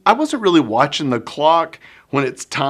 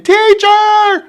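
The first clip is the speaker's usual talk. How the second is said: high, much louder yell; screaming child; 12 dB louder than usual. Retold or imitated. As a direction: yell for attention across the room. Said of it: "and screaming and screaming".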